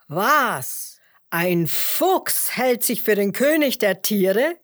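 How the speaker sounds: loud outraged lion voice; indignant roar. A narrator's reading. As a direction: exclaim it loudly, affronted. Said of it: "brüllte der Löwe empört". (empörtes Löwengebrüll)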